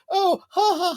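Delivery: very high mock tickled laugh; hammed-up ewok giggling; mock giggle. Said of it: "They're like".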